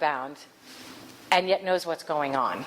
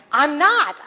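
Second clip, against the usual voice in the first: indignant woman; 9 semitones higher, 8 dB louder than usual. Retold or imitated. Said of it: "she looked at me and she went".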